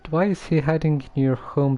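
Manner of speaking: ordinary talk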